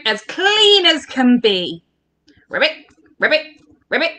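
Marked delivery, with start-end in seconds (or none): 2.5-4.1 short rising ribbits; frog noises. Shown as a demonstration. Showing the children frog noises, short croaks. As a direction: croak in separate little bursts. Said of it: "Let's hear your frog noises".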